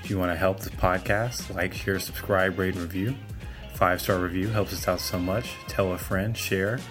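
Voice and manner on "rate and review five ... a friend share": ordinary talk, nothing marked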